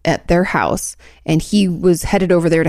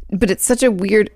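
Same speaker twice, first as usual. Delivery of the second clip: ordinary talk, nothing marked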